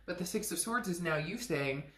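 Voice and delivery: usual speaking voice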